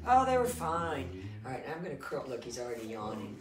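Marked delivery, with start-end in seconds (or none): 0.1-1.3 emphatic. Real, not acted. Emphatic reply.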